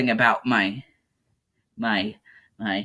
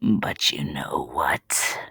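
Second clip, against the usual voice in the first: whisper; 12 semitones lower, 5 dB quieter, much slower than usual. Real, not acted. Conspiratorial aside after card.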